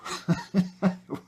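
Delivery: short high self-conscious laugh; sheepish high laugh. Real, not acted. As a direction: laugh at yourself for getting carried away. Embarrassed after getting excited.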